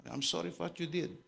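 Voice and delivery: softly quoted plea for forgiveness; quiet, understated imitated apology; retold apology. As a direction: quote a humble apology, hushed. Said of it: "Hingi siya ng tawad".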